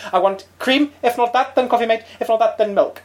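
Chris Eubank voice. Retold or imitated. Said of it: "And he goes".

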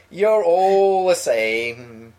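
very slow, stretched-out teasing; drawn-out taunt. Acted. Teasing Laura over Dudley and Kidderminster, drawn-out taunt. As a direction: draw out every word of "You're all the same".

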